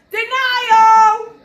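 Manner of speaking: loud, high declaimed "denial"; big, ringing outburst in a poem; emphatic proclamation. Acted. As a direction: call out the stage name at full voice. Proclaims first stage of grief.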